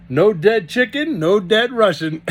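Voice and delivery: put-on hearty Russian accented farmhand. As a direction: bellow it like a big cheerful Russian. Grigori's first words, broken English.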